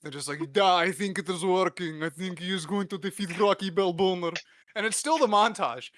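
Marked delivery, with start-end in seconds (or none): 0.5-4.3 put-on light Russian accent, pleased; a hint of a Russian lab scientist; mock Russian scientist. Scientists nodding over Rocky's training, in a mock Russian accent.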